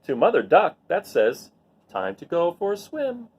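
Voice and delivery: put-on gentle storybook reading voice for children; read-aloud narration. A narrator's reading. Teacher reading a rain picture book to her students, gentle storytelling voice. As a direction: read it like story time to a class.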